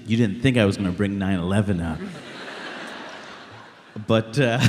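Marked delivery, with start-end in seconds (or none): 2.0-3.2 soft breathy laugh; a quiet laugh after the punchline. Real, not acted. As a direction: chuckle at his own dark joke. Laughing after nine eleven joke.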